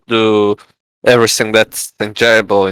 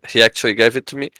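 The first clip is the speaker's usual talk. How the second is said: ordinary delivery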